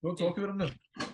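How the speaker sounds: squeaky put-on voice, well above his own; mock high voice. Teasing co-host about a friend, in a squeaky mock voice. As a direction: tease in a silly high voice.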